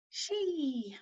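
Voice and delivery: "She" with a soft, high, long EE; long I fada. Shown as a demonstration. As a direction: softly stretch the vowel of "She". Repeats the long mouse vowel.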